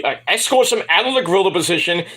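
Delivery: usual speaking voice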